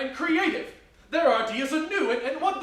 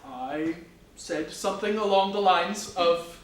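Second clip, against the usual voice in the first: normal talking voice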